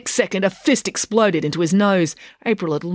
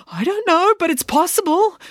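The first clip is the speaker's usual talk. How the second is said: mumbled; 12 semitones higher, 4 dB louder than usual. A narrator's reading. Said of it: "he mumbled".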